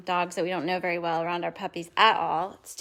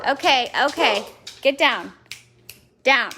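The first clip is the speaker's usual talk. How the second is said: louder, firm get down; firm command; 3 semitones higher, 7 dB louder than usual. Real, not acted. Owner to jumping dog Heidi, firm loud command. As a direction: tell the dog off firmly.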